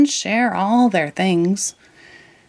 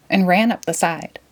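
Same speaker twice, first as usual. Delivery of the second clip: plain reading voice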